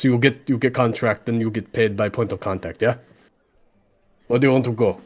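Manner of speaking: put-on small rabbit-folk contractor with a Slavic accent; businesslike sales patter in broken, Slavic-accented English; Anton. Game master plays Anton, contractor.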